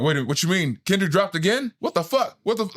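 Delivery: mock panic. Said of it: "And then as soon as he's like".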